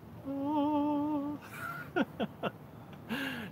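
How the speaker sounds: long high sustained "ooo", then chuckle; playful whoop into laugh. Real, not acted. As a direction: let out a soft high wheee, then laugh. Spins camera after asking "Ready?.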